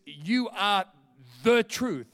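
pause, then land firmly on "the" before "truth"; stress on THE. Retold or imitated.

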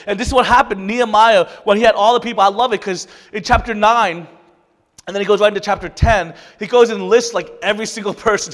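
loud, rising sermon delivery with pushed emphasis; fervent preaching. Acted. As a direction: preach it to the whole church. Preaching the Nehemiah story.

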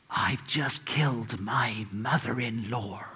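very slow, low whisper; under his breath. Retold or imitated. President to the Bolivian ambassador, whispered under his breath. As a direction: whisper the confession word by word. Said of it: "whispered under his breath".

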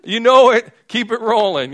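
high and loud, faking cheerful confidence; bluffing enthusiasm. Retold or imitated. Groom faking it with a thumbs up to the master, loud and high. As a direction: throw it out big like you're in on it. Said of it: "Be like".

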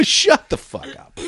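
high, drawn-out exasperated protest; slow, high-pitched incredulous retort; mock disbelief. Retold disbelief at pilot's hesitation.

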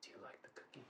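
hushed, low creepy whisper; dark creepy date. Retold or imitated. Storyteller imitating her date turned dark, creepy whisper. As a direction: imitate a sinister lover, barely audible. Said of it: "He turned dark all of a sudden".

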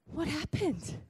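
soft, breath-held asking; quiet, awed prompting; hushed question. Retold or imitated. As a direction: ask it gently, almost under your breath. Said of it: "And I'm like".

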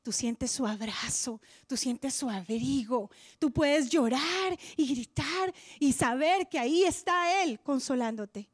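ordinary talk

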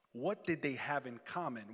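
ordinary talk, nothing marked

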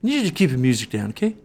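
a touch of a friendly Canadian cop; mock polite cop. Comic imitating the cop asking them to keep music down. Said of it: "he goes like".

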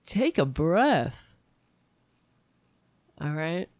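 slow, measured calming voice; mock the producer telling her to breathe; slow condescending take a breath; producer's line. Producer voiced in retold story.

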